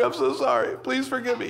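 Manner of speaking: high, fading pleading sorry; retold teary plea trailing off; tearful apology. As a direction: let the second sorry sink into a soft plea. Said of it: "I'm, like, crying".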